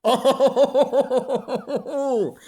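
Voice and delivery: loud, high run of hooting laughter; mocking laugh. Real, not acted. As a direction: hoot with laughter into a long "oh". Laughing at sunburned people.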